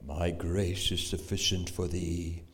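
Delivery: deep, slow, solemn voice of God. Acted. Preacher gives God's answer to Paul, deep and slow. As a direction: let God speak low and slow. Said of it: "And God said unto him".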